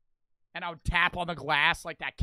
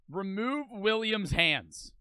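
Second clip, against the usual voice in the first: slow, deliberate dictation; writing it out; slower than usual. Pretend. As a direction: spell out each wish slowly, with pauses. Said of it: "I would write in the book".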